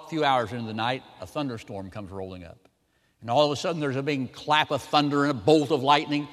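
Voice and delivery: ordinary delivery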